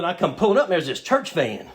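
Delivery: ordinary delivery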